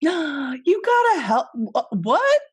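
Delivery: gasping, high, stammered disbelief; excited surprise. Real, not acted. Friend spots the new mug, excited surprise. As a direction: stumble over the words in delighted shock.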